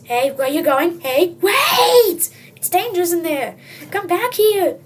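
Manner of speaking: loud, high calls after someone; calling out. Acted. Narrator calling the reader back from the woods, loud. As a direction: shout a warning to come back.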